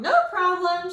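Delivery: high, bright cheerful reply; breezy, unbothered teacher. A narrator's reading. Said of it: "she said cheerfully".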